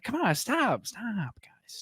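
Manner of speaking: high, fading to a whisper; plead, then drop to a hushed stop; mock pleading. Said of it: "It's just like".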